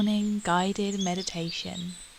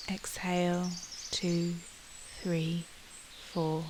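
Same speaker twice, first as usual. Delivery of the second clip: relaxed, lowered "two, three, four" with pauses; exhale count; 5 dB quieter than usual. Shown as a demonstration.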